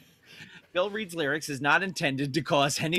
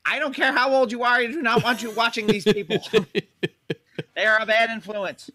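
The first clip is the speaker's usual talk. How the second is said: a hint of a scolding grandma; stern old mom; 5 semitones higher, 3 dB louder than usual. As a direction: scold like a strict elderly mother. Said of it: "Gail's mom's gonna be like".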